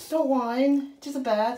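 usual speaking voice

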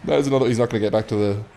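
ordinary talk

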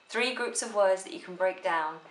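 ordinary talk, nothing marked

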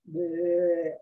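low, drawn-out imitated bleat; long low animal call.

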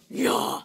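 a forceful breathy grunt of nya; manly grunted nya. Shown as a demonstration. For chat asking the manliest nya, a loud grunted nya. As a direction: grunt it out, all power. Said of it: "I'll give you a manly nya".

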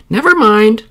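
high, loud, brushing it aside; a bossy old woman waving it off; old woman's voice. Acted. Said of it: "said the old woman".

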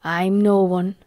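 lightly voiced dry old gardener; understated deadpan in dialect. A narrator's reading. Old gardener answering the astonished boy, dryly. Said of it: "said old Ben dryly".

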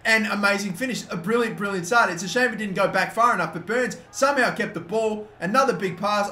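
high, loud, thrilled goal commentary; excited play-by-play. Real, not acted. Gamer commentating on his left back's goal, excitedly.

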